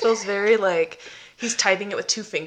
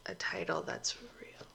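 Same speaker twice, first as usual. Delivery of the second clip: whisper; 3 semitones lower, 14 dB quieter than usual. Real, not acted. Aside to listeners.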